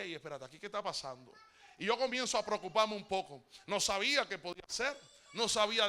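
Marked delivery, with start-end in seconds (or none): 0.0-1.3 hushed alarm. Retold or imitated. Alarmed inner question.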